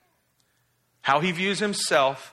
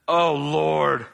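drawn-out exasperated groan to God; mock Jonah's put-upon prayer; acted exasperated prayer; 4 dB louder, much slower than usual. Preacher mimicking angry Jonah praying to the Lord, exasperated. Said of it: "that's kinda what he did".

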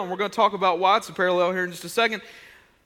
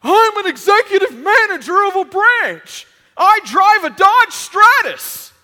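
much higher, louder, slow self-important bragging; pompous sitcom dad; 13 semitones higher, 14 dB louder, slower than usual. Retold or imitated. Sketch dad boasting at the family table, loud, high and pompous. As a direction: puff up like a blustering dad boasting. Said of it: "the dad is constantly going".

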